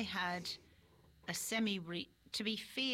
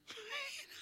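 high laugh; 5 dB quieter than usual. Real, not acted. Laugh after sheepish reply.

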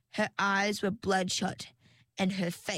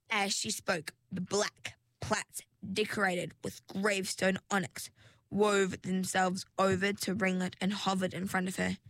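usual speaking voice

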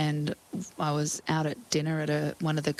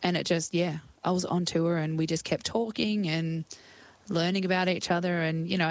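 normal talking voice

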